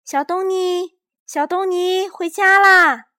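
high, loud, drawn-out call; mother calling son from the balcony. A narrator's reading. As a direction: call the child home from afar. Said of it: "妈妈从阳台上喊他".

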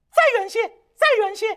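high, loud monkey cry; monkey calling. A narrator's reading. Monkey calling his master from under the mountain, high and loud.

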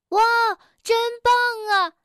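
high, loud amazed wow; marveling. A narrator's reading. Frog marveling at the storks overhead, amazed. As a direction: gasp in wonder at the birds. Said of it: "跳跳惊叹道".

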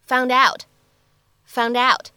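"found out" linked into one word, slow, d running into out. Shown as a demonstration. Teacher models the linking of "found out" slowly for students. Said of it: "会变成found out".

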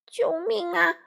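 high, loud cry for help; frantic squawking. A narrator's reading. Crow pinned under the wolf, frantic squawking. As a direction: squawk desperately for rescue. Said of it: "花袜子呱呱乱叫".

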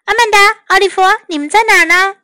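high, searching call. A narrator's reading. Mother looks for Amanda and Oliver, high calling voice. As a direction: call out to find the children. Mother calls the children upstairs.

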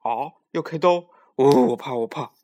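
the pig hearing about the surgery and panicking, yelling; frightened cry. A narrator's reading. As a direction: scream it out in terror.

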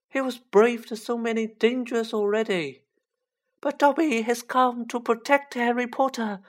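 high-pitched elf warning; devoted little servant, high and insistent; Dobby, earnest house-elf. Acted.

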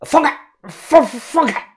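high, loud, stammered demand; choked shouting. Acted. Manager seized by the throat, yelling to be released. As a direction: struggle to get the words out.

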